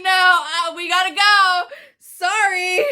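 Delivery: high, loud, tearful half-shout; loud, teary retold plea; crying insistence. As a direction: sob the words out while hurrying to leave. Crying while insisting on leaving.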